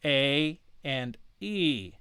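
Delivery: A and E spoken very slowly, ah then eh held apart; name each vowel sound slowly; slow letter sounds. Shown as a demonstration. Teacher spelling out the parts of the diphthong. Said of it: "where it says".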